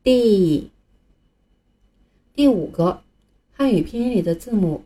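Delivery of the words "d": "D" stretched into a long dee; 拉长声音读D; drawn-out D. Shown as a demonstration. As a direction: hold the ee of "D" out. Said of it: "把声音拉长".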